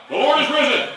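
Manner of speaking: very loud, high rallying cry; unshy shout. Real, not acted. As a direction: shout it to get a bigger response. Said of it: "That's too shy".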